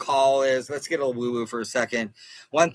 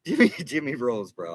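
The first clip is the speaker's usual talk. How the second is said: ordinary talk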